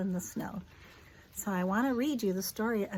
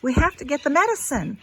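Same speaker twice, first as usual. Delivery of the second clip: urgent doctor; 6 semitones higher, 10 dB louder, much faster than usual. A narrator's reading. Doctor voiced in the story.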